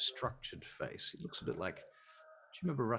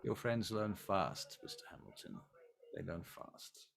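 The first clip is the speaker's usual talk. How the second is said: cold vampire prince; 3 semitones lower than usual. Acted. Prince continues cold rebuke.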